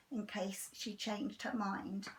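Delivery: usual speaking voice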